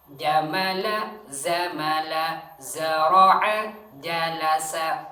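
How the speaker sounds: Jamala, zamala, zara'a said loud and slow, J against Z; pronunciation drill. Shown as a demonstration. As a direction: drill ja and za apart. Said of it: "Ja sama za". (latihan ja dan za, keras)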